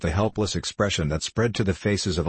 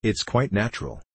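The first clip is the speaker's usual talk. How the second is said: ordinary delivery, nothing marked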